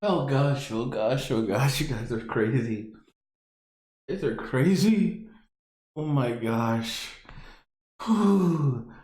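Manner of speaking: exhausted after a laughing fit; worn out from laughing. Real, not acted. Streamer recovering from laughter, worn out. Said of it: "You guys got me laughing too much".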